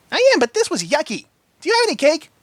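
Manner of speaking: high, loud pony voice; pestering Pinky. A narrator's reading. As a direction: whine for cake.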